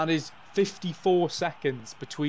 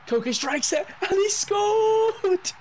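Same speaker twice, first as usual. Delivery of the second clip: much higher, loud stretched shout on scored; goal call; 7 dB louder, much slower than usual. Acted. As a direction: scream the goal like a TV commentator. Commentating a late winning goal.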